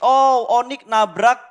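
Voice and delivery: sudden hyped call, drawn out; excited. Real, not acted. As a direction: burst out with excitement. Caster excited at team crash. (seruan heboh)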